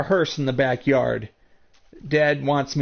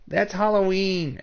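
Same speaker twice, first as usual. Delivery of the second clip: toned-down repeat, somewhat higher; echoing the mock exclamation; 7 semitones higher than usual. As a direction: say it again, lighter and less exaggerated. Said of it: "people are like".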